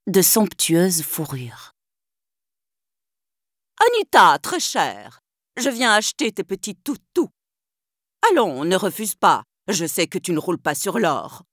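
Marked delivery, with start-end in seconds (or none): 3.9-11.4 wheedling rich shrew, sharp and affected; Cruella yelping. Acted. Cruella to Anita, wanting the puppies, a sharp yelping voice. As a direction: Cruella coaxing then sneering. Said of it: "glapit-elle".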